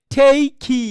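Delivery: ঠেইখি with a sounded "ee" tacked on the end; wrong pronunciation demo. Shown as a demonstration. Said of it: "তাহলে কেমন হতো জানেন". (ঠেইখি, শেষে ভুল ই যোগ করে)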